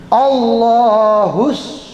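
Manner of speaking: "Allahus" very slow, with an s hissed through pursed lips like a whistle; sod, lips rounded. Shown as a demonstration. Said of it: "mulutnya monyong".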